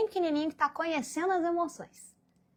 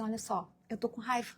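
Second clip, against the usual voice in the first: much softer, calmly naming the anger; soft admission of anger; 3 semitones lower, 11 dB quieter than usual. Retold or imitated. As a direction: quietly own up to being angry. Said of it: "fala assim". (admitindo a raiva baixinho, com calma)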